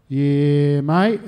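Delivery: loud, stretched "yeah" lifting into "mate"; laconic veteran. Retold or imitated. Veteran confirming his service to a caller, loud drawled reply. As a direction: play a comic, drawn-out phone answer. Veteran's repeated phone answer, acted.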